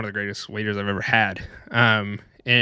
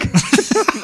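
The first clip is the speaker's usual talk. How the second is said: high, slightly louder burst of laughter; 4 dB louder than usual. Real, not acted. Laughing at the end of the restaurant story, high laugh.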